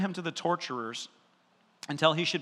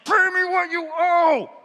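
high, slow, furious shout of a creditor; angry shout; 12 semitones higher, 8 dB louder, slower than usual. Acted. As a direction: roar the demand, hand on his throat. Servant grabs debtor by throat.

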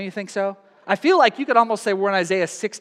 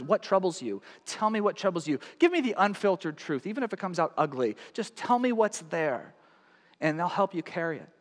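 soft, coaxing friend's voice; gentle invitation; 2 semitones lower, 7 dB quieter than usual. Pretend. Friend gently inviting someone to share. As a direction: quietly urge them to open up.

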